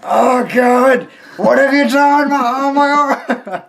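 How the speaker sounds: high hammed-up cry of pain; overacted shriek about an arm; mock pained scream. Mock injury from toy.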